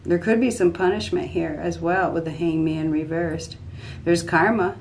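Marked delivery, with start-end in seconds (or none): none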